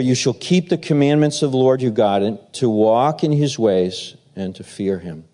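ordinary talk, nothing marked

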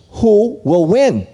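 put-on a worried boy begging to know; anxious little boy. Boy asks grandfather who wins.

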